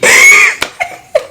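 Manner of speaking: high, loud cackle trailing off; real burst of laughter. Real, not acted. Vlogger amused by her own imitation, bursting out laughing. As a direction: crack up helplessly.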